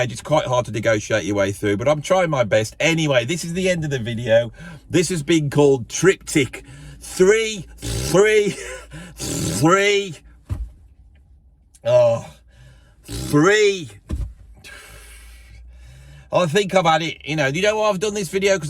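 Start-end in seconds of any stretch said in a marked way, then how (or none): 4.9-13.9 slow, grand mock announcement; drawn-out title. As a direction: stretch the title out for show. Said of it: "drawn-out mock title announcement".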